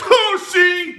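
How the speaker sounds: high, loud gotcha; mock gloating arguer. Host mimics bicycle defenders crowing over him, mock gloating. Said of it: "And they're like".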